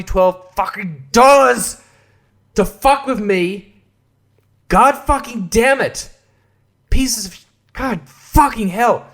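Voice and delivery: slow, high-pitched exasperation; angry swearing. Retold or imitated. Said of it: "Yeah, I was pretty mad".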